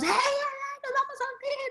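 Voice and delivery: squealed mock alarm of sinking sailors; mock panicked clamor. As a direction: imitate a crowd crying out in panic. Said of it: "Al griterío de todos".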